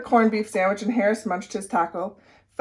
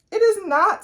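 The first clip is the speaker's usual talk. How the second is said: indignant; 11 semitones higher, 4 dB louder than usual. A narrator's reading. Carla protesting the teasing.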